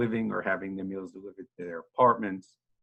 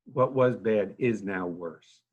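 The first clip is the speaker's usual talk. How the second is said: slower, dropped back to normal volume, letting "was" and "bad" sink in; slower than usual. Real, not acted. Director repeating his grim point to reporters, slow and subdued. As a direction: let the repeated words settle, slowly.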